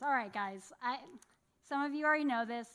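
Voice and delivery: ordinary talk, nothing marked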